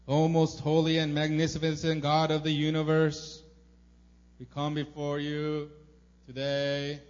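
hammed-up holy praying, drawn out at the end; pompous formal prayer. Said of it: "Or do you pray like".

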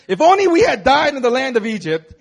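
loud, high, laughing mock lament; melodramatic. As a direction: ham up the despair like a soap opera. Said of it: "this sounds so melodramatic".

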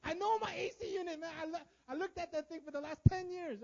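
high, loud, worked-up insistence; hammed-up aggrieved outburst; indignant protest. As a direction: burst out in high-pitched self-justification. Flesh protesting against own apology.

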